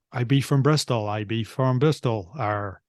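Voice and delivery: Bristol accent with heavy R's; quick Bristol accent. Shown as a demonstration. Co-host shows off a quick Bristol accent. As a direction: do a clichéd Bristol accent, ending on "Arr". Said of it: "I'll do a quick Bristol one".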